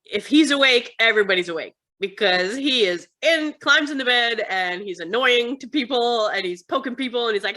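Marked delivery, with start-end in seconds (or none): none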